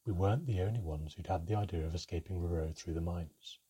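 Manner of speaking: ordinary delivery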